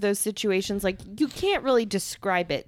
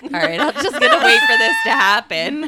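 impatient quote; 8 semitones higher, 8 dB louder than usual. Retold or imitated. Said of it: "just been like".

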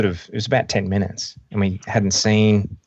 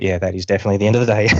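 rattled off fast and wry; a fast, weary joke to yourself; wry quick aside; 3 dB louder, much faster than usual. Retold or imitated. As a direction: toss it off quickly, dryly amused. Wry thought after knife mishap.

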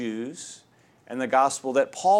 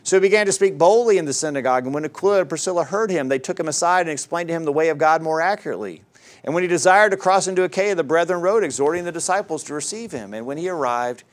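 brisk, louder Bible reading at speed; fast, raised reading of Acts; scripture reading; 4 semitones higher, 6 dB louder, faster than usual. Acted. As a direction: rattle through the passage from the pulpit.

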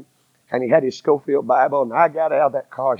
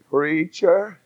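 higher, wavering plea from a lost man; quivering voice; 6 semitones higher than usual. Retold or imitated. Trucker calling the preacher over the radio, voice quivering. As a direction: let the voice tremble as he calls out. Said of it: "it was a quivering voice".

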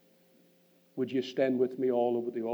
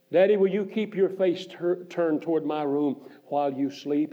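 put-on higher, louder, pleading like a small girl; pleading child's voice; 5 semitones higher, 8 dB louder than usual. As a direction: beg like a little girl at bedtime. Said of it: "I hear her emotion-filled voice again".

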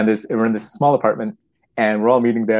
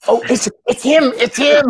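much higher and louder, starstruck squeal; mock excited fan; 6 dB louder than usual. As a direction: squeal like spotting a celebrity. Excited realization of stranger.

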